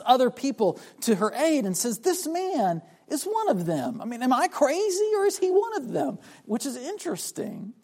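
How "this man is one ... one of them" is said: high, incredulous voicing of an accuser; acted-out disbelief, pitched well up; insistent appeal. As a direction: appeal to bystanders in a raised pitch. Servant girl's appeal to bystanders, acted.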